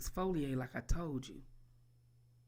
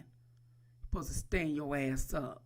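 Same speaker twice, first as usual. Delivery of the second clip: ordinary talk, nothing marked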